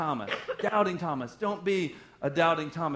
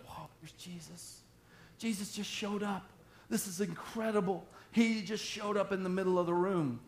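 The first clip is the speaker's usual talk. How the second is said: hushed awe; 7 dB quieter than usual. Acted. Awe at Jesus appearing.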